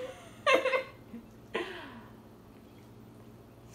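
laughing through a full mouth; snorting laugh round marshmallows; muffled laugh. Real, not acted.

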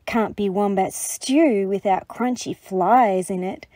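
ordinary delivery, nothing marked

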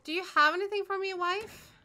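scared gamer pleading with the wife; frantic plea. Real, not acted. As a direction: beg quickly in a panic. Pleading to game character.

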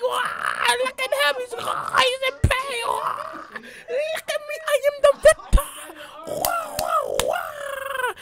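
very high, very loud falsetto gloating; squealing victory hype. Acted. Gamer voicing his fighter over the beaten father, shrieking falsetto gloat. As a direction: crow over the fallen opponent in a shriek. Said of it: "And he's like".

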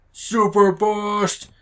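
high, drawn-out special-move call; mock battle cry. Player cop calling out his special move, mock battle cry. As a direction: stretch it out like announcing a super move. Playing the cop making a bust.

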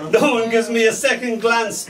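high, put-upon moan; a lightly voiced sulking Grey. Grey moaning to the other colours, high and sulky. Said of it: "moaned Grey".

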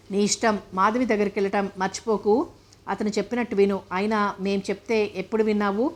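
plain reading voice

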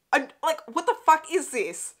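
high, incredulous exclamation; baffled outburst. Real, not acted. Viewer baffled by a campy scene, loudly incredulous. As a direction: build to a loud, high cry of disbelief.

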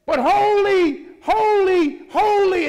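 high, loud, slow "holy, holy, holy"; loud angel praise. Acted. Angel turning to call praise to another, loud and high. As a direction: cry it out, then break into a laugh.

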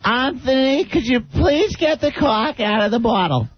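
high, slow Massachusetts lady asking sweetly; a Massachusetts local voice, high and slow; Massachusetts accent. Retold or imitated. Host recalling neighbors up north in a Massachusetts accent. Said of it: "When I was living up there".

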